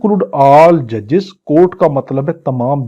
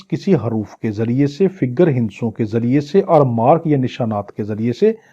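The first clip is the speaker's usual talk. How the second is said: usual speaking voice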